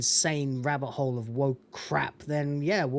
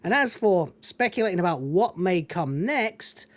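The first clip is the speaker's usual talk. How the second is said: rising rant; 7 semitones higher, 4 dB louder than usual. Real, not acted. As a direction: pitch climbs high, getting louder.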